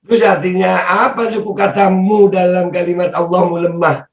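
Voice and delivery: ordinary talk, nothing marked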